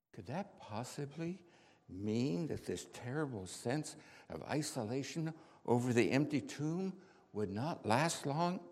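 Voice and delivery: ordinary delivery, nothing marked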